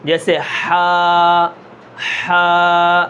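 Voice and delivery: slow ہا with a clean open vowel; صاف ہا; clear ha. Shown as a demonstration. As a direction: model ہا cleanly, no catch at the end. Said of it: "آواز بالکل صاف ہو".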